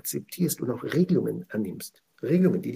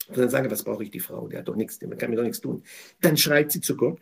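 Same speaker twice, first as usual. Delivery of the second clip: usual speaking voice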